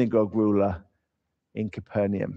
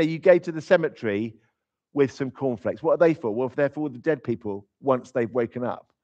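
ordinary delivery

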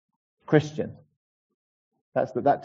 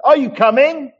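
loud, high holler down a long corridor; resonating call; 13 semitones higher, 11 dB louder than usual. Acted. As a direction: call so it rings through the house. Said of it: "his voice resonates down the corridor and reverberates".

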